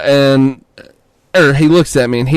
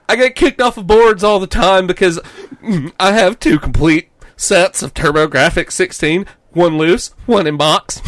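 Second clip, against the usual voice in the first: much higher, bragging bro voice; imitating the bro; 7 semitones higher, 5 dB louder than usual. Quoting the clerk, high bragging bro voice. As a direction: brag about mods like a bro. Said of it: "He's like".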